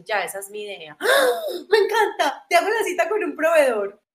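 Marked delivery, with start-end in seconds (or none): 1.0-1.4 a sudden very high, very loud shriek of delight. Retold or imitated. Ex-boyfriend hears her notebook idea, squeals with delight. 1.7-4.0 much higher and louder, gushing; thrilled. Retold or imitated. Ex-boyfriend gushing about her notebook idea, thrilled and loud.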